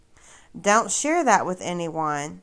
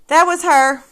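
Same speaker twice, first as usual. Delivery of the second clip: gotcha reveal; 8 semitones higher, 9 dB louder than usual. Real, not acted. Exposing the fake account.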